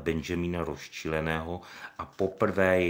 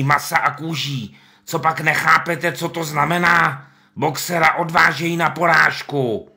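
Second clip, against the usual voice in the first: much higher, loud, slow reading out; agitated reading; 9 semitones higher, 7 dB louder than usual. Acted. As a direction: read the sign aloud in alarm. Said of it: "do hlubokého ticha četl".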